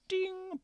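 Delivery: high sung "ding" like a timer bell; oven ding. Retold or imitated. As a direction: chime it like a microwave.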